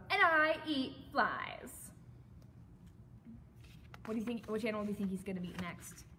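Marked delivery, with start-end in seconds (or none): 0.1-1.9 slow, loud boast with swooping pitch; big-mouthed frog announcing himself loudly; shouted. A narrator's reading. Frog introduces himself to another animal, shouted. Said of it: "shouted the wide-mouthed frog".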